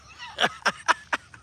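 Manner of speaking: high, wheezy chuckle; laugh. Real, not acted. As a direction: burst of amused, high-pitched laughter. Laughs after teasing Ronnie.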